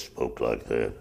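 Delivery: low gruff voice imitating an old lawyer; rough, low growl of a voice. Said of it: "who had a real gruff voice".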